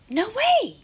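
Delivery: high, loud cry of amazement; astonished shriek. Real, not acted. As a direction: squeal with sudden delight. Surprised by pop-out puzzle.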